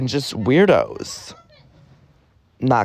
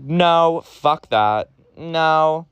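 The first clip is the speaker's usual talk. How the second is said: imitating parents' angry refusal; 7 semitones higher, much slower than usual. Parents shutting down her explanation.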